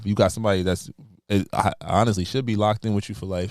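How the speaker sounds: normal talking voice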